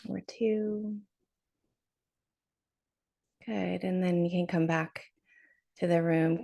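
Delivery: unhurried meditation guide, long pauses between phrases; guided meditation. Real, not acted. Teacher leading arrivals on Zoom into a short drop-in, guided meditation. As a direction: settle them gently into the body, leaving space.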